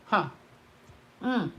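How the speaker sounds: normal talking voice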